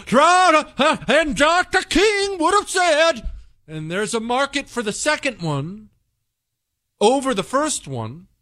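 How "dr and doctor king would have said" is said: high, drawn-out mock preacher cadence; mocking the speaker. Host parodying a preacher who went from well-spoken to this. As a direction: ham up his sermon style slowly. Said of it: "this guy can go from being well-spoken".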